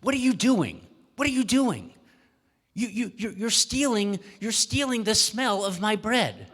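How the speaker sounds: loud, high, accusing baker; furious baker shouting at the man; angry shout. Acted. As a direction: yell it at the neighbour, outraged. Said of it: "the baker came out and was shouting at him".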